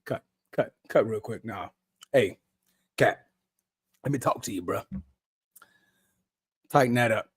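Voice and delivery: soft, low word aside; discreet intervention. Pretend. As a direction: quietly pull him aside to correct him. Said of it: "I'd have been like".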